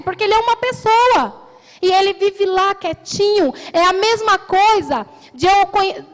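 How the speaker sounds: full-force pulpit preaching; fervent preaching. Acted. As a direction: preach it out with rising urgency. Preaching builds louder, higher.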